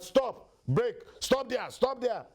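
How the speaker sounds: high, loud halting command; robbers' shouted orders. Acted. Armed robbers stopping a car, shouting orders. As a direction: shout them to a standstill.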